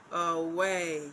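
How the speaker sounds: "away" with a light "a" and a stressed "way"; "away" as an iamb, second syllable drawn out. Shown as a demonstration. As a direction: lean on the "way" in "away". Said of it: "Unstressed syllable followed by a stressed syllable".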